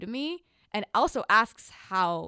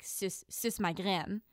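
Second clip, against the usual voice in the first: Quebecois French; 5 dB quieter, slower than usual. Retold or imitated. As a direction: say "Suce, suce ma graine" in Quebec French, slowly. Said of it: "he would say".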